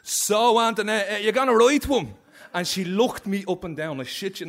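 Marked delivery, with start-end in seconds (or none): 0.0-2.1 higher, louder, cheeky prodding question; nosy friend. Retold or imitated. Friend interrupting to ask the visitor about writing. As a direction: butt in and put her on the spot. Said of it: "he says".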